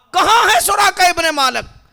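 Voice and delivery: high, full-force summoning shout; loud call. Acted. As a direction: call out for a missing man. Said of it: "زور سے آواز دی". (زور دار بلند پکار)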